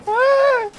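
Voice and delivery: sudden high yelp of pain; shriek. Real, not acted. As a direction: cry out, startled, very high. Sudden shriek when hit.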